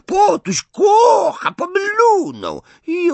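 slow, very high, loud jeer; gloating imp at full screech; screeching taunt. A narrator's reading. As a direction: drag out the shrieked teasing chant. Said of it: "rechocząc i wrzeszcząc".

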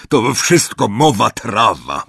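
loud, slow gruff dismissal; angry uncle. A narrator's reading. Uncle scoffing at Harry. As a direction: wave it off with scorn.

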